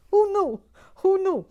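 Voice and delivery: very high, loud squawking Brooklyn exclamation; Brooklyn accent. Acted. Reading Sharon's comment in a loud mock Brooklyn voice. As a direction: shout it like a brassy New York aunt. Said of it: "your Brooklyn accent".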